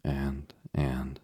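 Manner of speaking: halting, with a gap after each word; slow, hesitant. A narrator's reading. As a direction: stall on each "and", searching for words. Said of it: "began Joel slowly".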